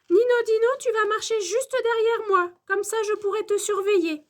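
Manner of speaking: high-pitched scolding, loud at the start; maîtresse qui se fâche. A narrator's reading. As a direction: order a pupil to stay close, crossly. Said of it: "se fâche la maîtresse". (réprimande aiguë de maîtresse)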